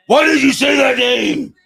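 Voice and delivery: anguished shout, high and loud. Acted. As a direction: yell it in desperate anguish. Movie quote shouted.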